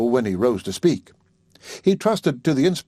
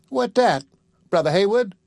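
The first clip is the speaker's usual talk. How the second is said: higher, lightly voiced woman asking eagerly; a curious widow, voice pitched up; curious Sally; 8 semitones higher than usual.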